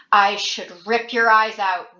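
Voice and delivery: crazed friend pinning Thomas down, slow loud threat; venomous threat. A narrator's reading. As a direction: spit the threat in his face. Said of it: "Newt said, spraying Thomas with spit".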